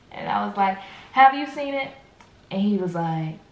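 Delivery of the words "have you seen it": raised, louder retold question; irritated demand. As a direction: ask it pointedly, high and annoyed. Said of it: "I just get irritated".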